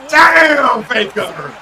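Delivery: real burst of hype at a big play; excited shout. Real, not acted. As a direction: shout it high and loud, falling off on the name. Streamer erupts at winning play.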